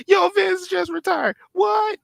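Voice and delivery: very high, loud squeaky voice; imitating Saul. Mocking Saul's shocked reaction on the call, squeaky. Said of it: "That sound like Saul".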